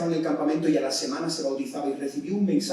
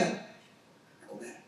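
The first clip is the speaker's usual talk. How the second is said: 1 whispered call; 12 dB quieter, much slower than usual. Retold or imitated.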